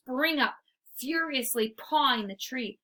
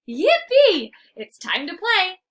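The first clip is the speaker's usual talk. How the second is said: high, loud burst of joy; yelping with glee; excited cheer; 8 semitones higher, 10 dB louder than usual. A narrator's reading. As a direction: squeal it with puppy delight. Excited dog narrator cheering.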